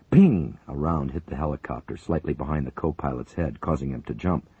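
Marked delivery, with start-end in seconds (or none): 0.1-0.6 sharp, high imitated ping; bullet ping. A narrator's reading. Narrator imitating a bullet hitting, sharp ping. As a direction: snap out the ricochet.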